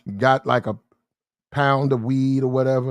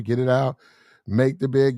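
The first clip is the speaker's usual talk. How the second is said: usual speaking voice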